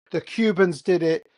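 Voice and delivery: ordinary delivery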